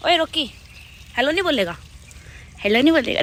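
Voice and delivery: coaxing the dog. Real, not acted.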